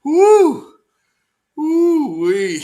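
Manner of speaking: a high, loud, excited whoop. Real, not acted. Cheering at the dancer on the video, a high, loud whoop. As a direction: let it rip like the clip thrilled you.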